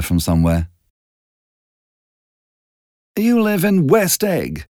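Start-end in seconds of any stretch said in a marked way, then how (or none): 3.2-4.6 higher, slow and a touch condescending; superior tone. A narrator's reading. Miss Baker placing Nick socially, slow superior tone. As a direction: look down on him, slowly. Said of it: "in a superior tone".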